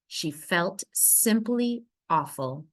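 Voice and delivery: usual speaking voice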